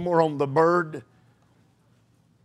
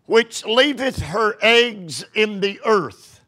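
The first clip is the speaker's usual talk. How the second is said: scripture reading; 5 semitones higher than usual. Acted. Reads scripture, slow and raised.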